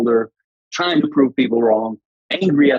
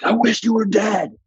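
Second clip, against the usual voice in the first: high, louder mock yell of hatred; retold yell; 12 semitones higher, 3 dB louder than usual. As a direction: fling the words at the old coach, pitched way up. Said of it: "And I yelled at him".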